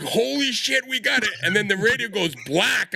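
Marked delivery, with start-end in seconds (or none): 0.0-1.3 high thrilled burst; excited. Retold or imitated. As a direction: relive the moment the contact came in. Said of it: "I was like".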